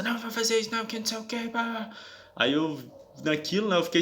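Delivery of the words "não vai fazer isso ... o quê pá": high-pitched agitated woman, imitated; mimicked nervous refusal. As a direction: mimic her worked-up objection up high. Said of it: "já nervosa".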